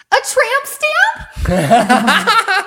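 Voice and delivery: high, loud, drawn-out shriek of shock with a laugh. Retold or imitated. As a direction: shriek it like a horrified mom. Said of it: "with the loudest shriek I've ever heard".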